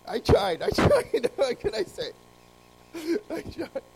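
laughing off a joke that flopped; giggly, high-pitched "I tried". Real, not acted. Laughing at his own joke.

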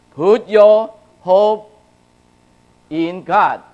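loud, slow, high preaching of the verse; rising preacher's call, drawn out; emphatic proclamation. Real, not acted. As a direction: hammer the verse home to the congregation.

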